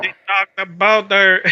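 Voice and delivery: put-on terrible French accent. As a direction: overplay a Quebec French voice. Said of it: "you sound like a terrible".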